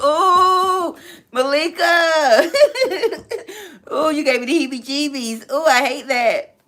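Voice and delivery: high, loud squealing disgust through laughter; real laughing squeal of disgust; grossed-out laughing. Real, not acted. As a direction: shudder and laugh at the thought of gristle. Grossed out by gristle comment.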